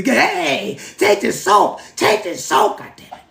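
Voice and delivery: high, laughing put-on voice handing out soap; mock ancestor scolding. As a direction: ham up the scolding while cracking up. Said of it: "sit there and say".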